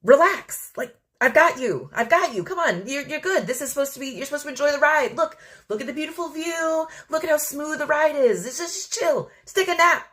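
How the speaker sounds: high, soothing, coaxing voice; the universe calmly reassuring a panicking girl. Retold or imitated. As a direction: gently talk a scared child down. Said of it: "the universe is looking at me like".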